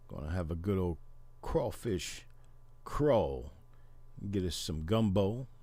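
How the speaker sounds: put-on a light Cajun accent, relaxed; lightly voiced Louisiana Cajun, savoring the food; easygoing Cajun accent talking crawfish boil. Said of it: "you at least need to sound like it".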